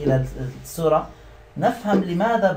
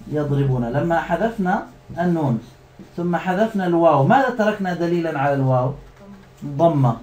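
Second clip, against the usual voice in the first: ordinary delivery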